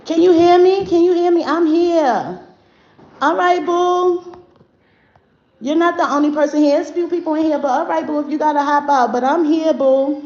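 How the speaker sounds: high, loud call to someone who can't hear; loud, high-pitched check-in with a viewer. Real, not acted. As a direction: reach out loudly to a lost listener. Calling out to a live viewer.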